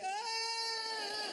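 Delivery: high frightened yelp; scared yelp. Retold or imitated. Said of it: "you're like".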